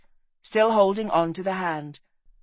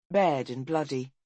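ordinary delivery

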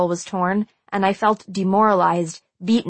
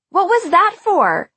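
high, loud indignant shriek; 11 semitones higher, 5 dB louder, slower than usual. A narrator's reading. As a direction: shriek it in outrage. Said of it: "Marcy shrieked".

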